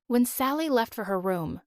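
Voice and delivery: ordinary delivery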